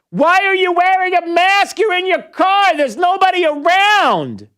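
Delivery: high, frustrated yell at an imagined driver; exasperated yelling. Acted. Radio host berates lone drivers for masking in cars, exasperated yelling. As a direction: yell it out, incredulous, high-pitched. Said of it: "I'm yelling because".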